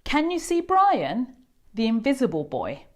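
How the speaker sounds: usual speaking voice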